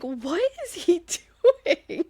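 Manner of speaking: high, laughing incredulous question; amused disbelief. Retold or imitated. Host reacting to Kyle standing in boxers, laughing disbelief. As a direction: ask it through a laugh, baffled. Reacts to creepy ghost story.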